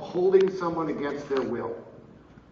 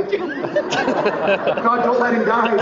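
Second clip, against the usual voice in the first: loud, high plea to God; raised, pleading prayer; mock desperate prayer; 8 semitones higher, 7 dB louder, much slower than usual. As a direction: call out to heaven in hammed-up alarm. Mock panicked prayer for collapsing prosecutor.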